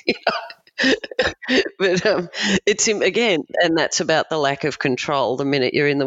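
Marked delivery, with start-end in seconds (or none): 0.8-1.7 short high burst of laughter. Real, not acted. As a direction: laugh at the absurdity. Laughs after witness remark.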